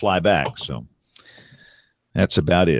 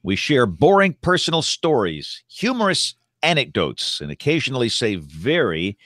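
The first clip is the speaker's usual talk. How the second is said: announcer voice; 4 semitones higher than usual. Acted. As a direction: open the show like a radio host. Show intro, host opening voice.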